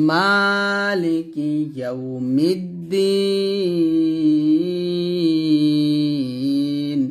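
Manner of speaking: মালিকি ইয়াউমিদ্দিন chanted very slowly, the দ্দি vowel held three beats; slow melodic Quran chant with a long madd; drawn-out recitation. Shown as a demonstration. Teacher models beautiful reading for students, slow chant. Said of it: "আমার সাথে আবার পড়েন".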